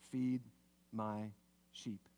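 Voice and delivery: low, hushed, word by word; quiet, gentle commissioning; tender command. Acted. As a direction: pause solemnly between soft words. Jesus tenderly commissions Peter.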